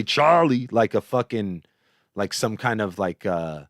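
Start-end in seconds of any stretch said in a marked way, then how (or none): 0.0-0.7 loud Prohibition-era gangster caricature; exaggerated gangster voice; mock 1920s gangster. Shown as a demonstration. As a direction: say it like an old-time gangster. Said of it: "like a nineteen twenties gangster or whatever".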